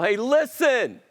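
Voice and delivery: high-pitched, insistent prophet's appeal; emphatic, lightly acted messenger of God; Samuel. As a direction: urge the king to listen, voice pitched well up. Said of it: "Samuel says to Paul".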